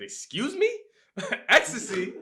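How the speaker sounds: high, loud startled squeal; a sudden shrill double take, laughing through it; shocked disbelief. Retold or imitated. Shocked reaction to being drugged.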